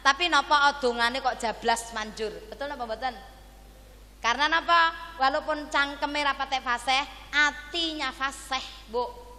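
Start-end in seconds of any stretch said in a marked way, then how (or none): none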